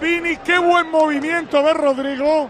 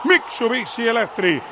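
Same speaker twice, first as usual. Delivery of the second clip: lower-pitched sponsor ad read; 4 semitones lower than usual. Acted. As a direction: read the ad like a spot.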